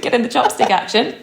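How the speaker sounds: normal talking voice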